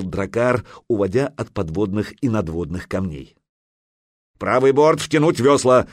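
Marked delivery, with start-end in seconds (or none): 4.4-5.9 loud, raised command; shouted order. A narrator's reading. Helmsman orders oars pulled in, shouting. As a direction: shout it urgently to the rowers. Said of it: "закричал Кительфаст".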